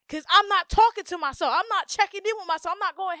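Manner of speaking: loud, high, insistent rant; exasperated. Real, not acted. Explaining why she gets so mad, exasperated rant. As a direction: hammer each admission out loudly. Worked up about neglecting herself.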